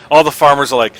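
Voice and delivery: ordinary talk, nothing marked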